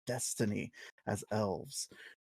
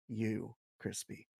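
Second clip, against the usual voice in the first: tender; 4 semitones lower, 8 dB quieter than usual. Acted. Pep talk to a nervous friend.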